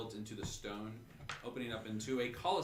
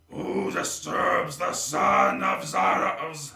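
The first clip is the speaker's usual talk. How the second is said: loud slow booming monster; villain voice; 5 semitones higher, 13 dB louder, slower than usual. Acted. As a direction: boom it out like a villain. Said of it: "It does say, like".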